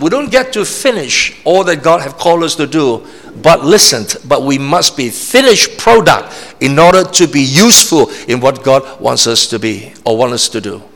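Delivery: normal talking voice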